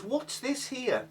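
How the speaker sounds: high, slow, suspicious mock mum; mimicking mum. Mum poking at her soup, high slow mock suspicion. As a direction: drag it out like a fussy old mum.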